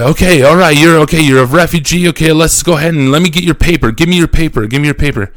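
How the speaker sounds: brusque official. Retold or imitated. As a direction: imitate a pushy checkpoint officer. Acted brusque official demanding papers.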